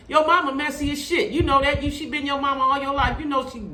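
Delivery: high-pitched, insistent scolding; fed-up telling-off. Pretend. Host tells Tisha the truth about her mama, fed up and high. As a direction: set her straight, pitched well up.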